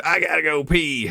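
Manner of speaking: hammed-up urgent passenger; disruptive passenger. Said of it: "I assume that's how she sounds".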